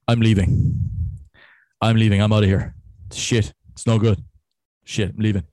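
loud retold grumbling exit; up close interjection. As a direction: blurt it right into the mic in short bursts. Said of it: "up close. He was just like".

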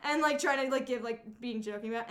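ordinary talk, nothing marked

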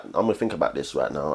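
low, quiet, rapid aside; acted cool-headed male reasoning; calculating guy. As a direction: think it through fast under your breath. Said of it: "the guy would always be like".

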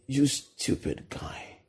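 soft, voiceless sneer; condemning inner voice. Retold or imitated. Inner accuser sneering at a sinner, whispered. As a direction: hiss it like a voice in your head. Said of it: "you hear a voice that says".

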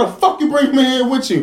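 high, loud mock yell through laughter; retold mad yell. Retelling yelling at the mother, high laughing mock rage. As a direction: throw the question at her, laughing. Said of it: "I was so fucking mad".

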